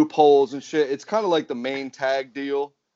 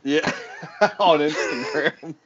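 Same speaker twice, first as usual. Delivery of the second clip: laughing; 3 semitones higher, slower than usual. Real, not acted. Laughing at the storyline joke.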